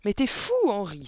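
loud, high outraged exclamation; indignant wife's outcry; crying out. A narrator's reading. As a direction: exclaim it at your husband. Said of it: "s'écria madame Verdebois".

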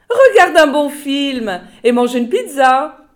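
high, loud, sugary office voice; chatty secretary. A narrator's reading. Secretary brushing off the boss's son, high and loud. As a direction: coo at him while filing your nails. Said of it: "C'était Grunda, la secrétaire".

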